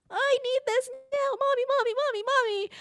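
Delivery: put-on very high, nagging child voice; whining five-year-old. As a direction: pester mommy in a high kiddie whine. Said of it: "Please use your regular voice".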